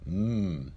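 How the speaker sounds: "M" as a drawn-out hummed sound; letter sound M. Shown as a demonstration. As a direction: sound out "M" alone, not the letter name. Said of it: "The Mem is pronounced as".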